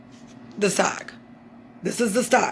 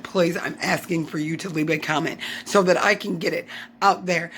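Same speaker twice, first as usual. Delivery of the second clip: normal talking voice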